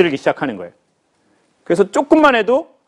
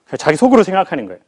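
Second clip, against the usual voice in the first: usual speaking voice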